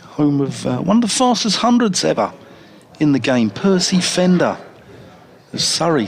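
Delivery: normal talking voice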